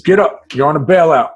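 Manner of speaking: loud, high guard voice; loud mock guard wake-up call; guard barking orders. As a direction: bark it like a jail guard waking you. Guard waking him in cell.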